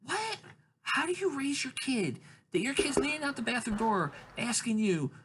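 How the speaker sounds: high-pitched incredulous rant; mock disbelief. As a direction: pitch it way up, appalled at the parenting. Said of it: "I'm thinking to myself".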